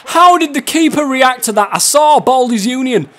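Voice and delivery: high, loud, worked-up outcry; loud exclamation. Real, not acted. Exclaiming at keeper's reaction in game.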